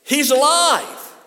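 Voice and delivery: preacher shouting the good news; triumphant shout. Acted. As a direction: shout it to the rafters. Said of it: "Say that with me".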